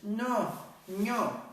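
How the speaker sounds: a hint of a fussy toddler; toddler refusal. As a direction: say "ño" like a small child refusing. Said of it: "el niñito".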